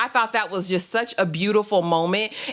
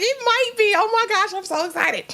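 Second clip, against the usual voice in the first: high-pitched giddy daughter impression; 12 semitones higher than usual. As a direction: gush to Mom in a high, excited voice. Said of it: "like, she's giddy".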